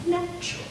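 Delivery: a light stretch on "natural". Shown as a demonstration. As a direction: ease out "natural" without overdoing it. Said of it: "we won't do it as exaggerated".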